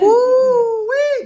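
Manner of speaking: high, loud gleeful whoop; excited whoop. Real, not acted. Host whooping as the reporter asks about Putin, a high gleeful yelp. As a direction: yelp with delight at the question.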